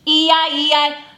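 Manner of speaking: normal talking voice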